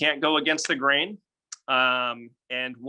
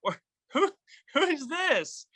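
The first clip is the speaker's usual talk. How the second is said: confused prospect; 12 semitones higher, slower than usual. Retold or imitated. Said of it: "they'll be like".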